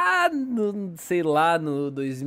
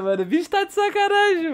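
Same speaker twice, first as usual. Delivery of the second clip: surprised. Real, not acted. Friend praises a joke anime.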